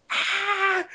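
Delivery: mock yelp of alarm; retold yelp. Telling listeners how the tear gas hit him, retold yelp.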